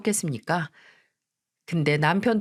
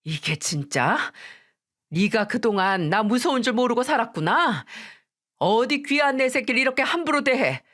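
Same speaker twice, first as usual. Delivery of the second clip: higher, menacing scolding; mother defending her daughter, threatening the mother-in-law; furious threat; 4 semitones higher than usual. Acted. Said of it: "제대로 화를 내기 시작했어요".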